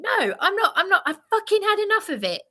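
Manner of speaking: high, fast and laughing, half-mocking her outburst; imitating Bryony laughing. Imitating Bryony's fed-up outburst, high, fast and laughing. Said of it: "Bryony is saying".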